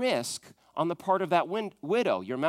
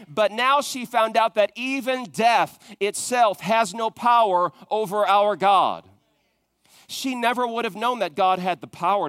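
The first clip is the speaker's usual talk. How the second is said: preaching at full force; 3 semitones higher, 5 dB louder than usual. Acted. The pastor builds toward the church's amen, full preaching force.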